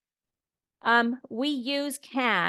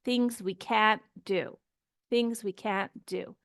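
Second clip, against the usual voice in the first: "can't" stressed, long and clear; emphasized negative can't. Shown as a demonstration. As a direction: lean on "can't". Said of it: "we emphasize it".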